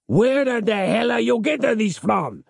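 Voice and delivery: high-pitched, outraged interrogation; angry demand. A narrator's reading. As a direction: demand it high, right in his face. Father thrusts locket at captive.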